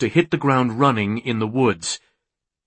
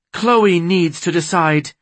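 higher, insistent woman's voice; defiant; 6 semitones higher, 4 dB louder than usual. A narrator's reading. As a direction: stand your ground, pitched up. Undaunted woman argues back.

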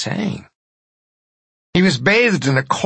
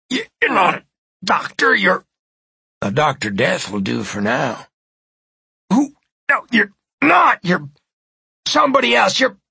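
much higher, stammering fear; panicked stammer; 5 semitones higher than usual. Acted. Victim realizes the doctor is a fake, panicked. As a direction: stumble over the words in terror.

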